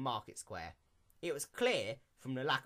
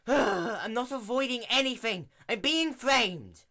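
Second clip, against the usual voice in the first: loud, high indignant shout; 11 semitones higher, 10 dB louder than usual. Acted. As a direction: protest at the top of your voice. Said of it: "Twilight shouted".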